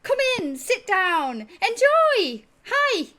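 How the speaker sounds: very high, very loud, eager greeting; excitable tabaxi. Acted. Tabaxi innkeeper rushing to greet the party, very high and loud. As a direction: squeal the welcome like an overexcited cat host. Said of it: "small tabaxi who kind of rushes over and he's like".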